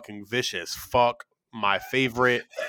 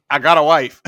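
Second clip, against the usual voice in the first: quoted line; 2 semitones higher, 11 dB louder than usual. Retold or imitated.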